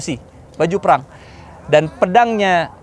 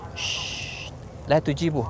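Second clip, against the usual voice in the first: hushing; 10 dB quieter, much slower than usual. Acted. Prophet to companions, hushed order not to answer. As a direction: hush them so nobody answers.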